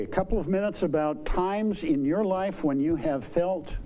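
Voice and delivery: ordinary talk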